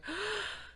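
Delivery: sharp high gasp of alarm; shocked gasp. Retold or imitated. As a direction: gasp as if you just saw his face. Sees husband's grimaced face.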